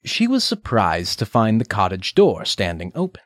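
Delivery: plain reading voice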